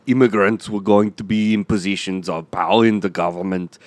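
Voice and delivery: put-on thick Austrian accent; Arnold Schwarzenegger. Guest explains history to kids, Austrian accent. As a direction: lecture like an Austrian bodybuilder. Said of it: "Arnold Schwarzenegger, come out".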